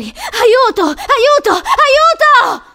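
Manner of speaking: very high, loud screams, building; drawn-out piercing cries of terror; screaming for help. A narrator's reading. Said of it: "non era più solo lei a gridare".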